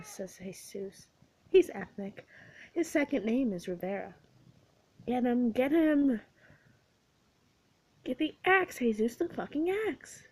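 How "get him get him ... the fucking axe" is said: frantic high yell. A narrator's reading. Kate yelling at Jesus during the zombie game. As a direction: scream it at the screen.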